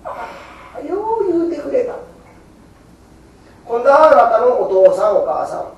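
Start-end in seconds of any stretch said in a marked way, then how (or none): 0.1-1.9 raised, delighted voice of Amida; a hint of Buddha's gladness; Amida rejoicing. Amida thanks the believer, raised and delighted. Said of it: "阿弥陀様が喜ぶ".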